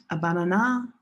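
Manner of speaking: "banana" stressed at the end, bana-NAH; misplaced stress. Shown as a demonstration. Teacher showing what nobody understands, "banana" end-stressed as bana-NAH. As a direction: push the last syllable of "banana", ban-a-NAH. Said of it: "nobody understands you".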